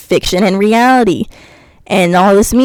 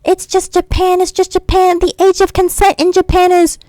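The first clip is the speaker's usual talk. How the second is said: high, whiny parody of an excuse-maker, much higher; mock defensive fan; 8 semitones higher than usual. Mocking commenters who excuse it as Japan, high whiny mimicry.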